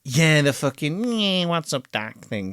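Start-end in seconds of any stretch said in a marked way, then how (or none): 1.2-2.2 mock Bugs Bunny accent. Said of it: "that shitty accent".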